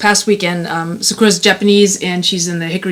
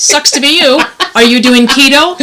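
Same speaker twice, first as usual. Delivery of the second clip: taunting; 4 semitones higher, 3 dB louder than usual. Retold or imitated. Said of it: "taunt me with them".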